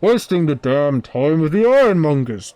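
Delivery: loud, ranting forge dweller; bellowing. Acted. Forge dweller cursing the departing visitor, bellowing angrily. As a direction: bellow indignantly after someone leaving. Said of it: "you can hear a voice bellowing afterwards".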